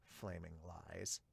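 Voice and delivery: deadpan aside. Real, not acted. Sarcastic aside about setters.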